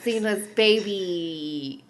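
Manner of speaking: ordinary talk, nothing marked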